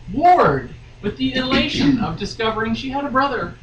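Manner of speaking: normal talking voice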